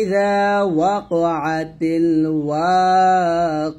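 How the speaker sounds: slow, drawn-out melodic tajweed chant; slow Quranic recitation. Shown as a demonstration. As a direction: recite it slowly and reverently, stretching each vowel. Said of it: "এখানে তাপেশ তু আছে".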